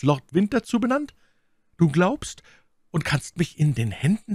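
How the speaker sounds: high, impassioned denunciation; My Lady, anklagend. A narrator's reading. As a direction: accuse him with rising outrage. (leidenschaftlich anklagende Lady, hohe Stimme)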